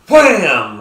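a loud shouted wham; mock slap sound. Mimicking the slap for his listeners, a loud wham. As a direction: bark the slap sound out like a hit landing.

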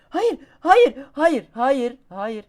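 very high, loud no fading down; panicked screaming. Real, not acted. Gamer screaming as his climber falls, panicked.

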